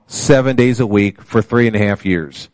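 ordinary talk

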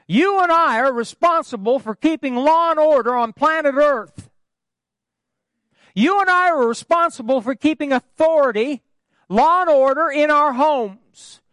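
high, loud building sermon cadence, hammering each our; fiery preaching. Acted. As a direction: preach it to the rafters, list by list. Preaching at full force.

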